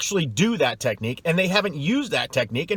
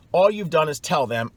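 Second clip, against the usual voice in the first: ordinary delivery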